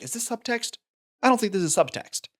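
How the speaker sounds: high-pitched questioning quote; doubting thought. Retold or imitated. Host recalling watching that scene, doubting.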